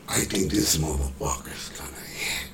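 hushed private whisper; whispered thought. Retold or imitated. Rapper thinking to himself at playback, in a whisper. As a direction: say it to yourself under your breath.

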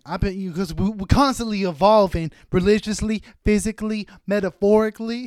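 high, loud, rambling meth-head voice; crackhead rambling. Retold or imitated. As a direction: preach nonsense like a wired street guy. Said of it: "crackhead talk".

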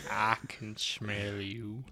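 slow, drawn-out actor impression; Morgan Freeman. Co-host quoting a video for laughs, slow Morgan Freeman voice. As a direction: stretch each word in a famous actor's drawl. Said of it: "talk like Morgan Freeman".

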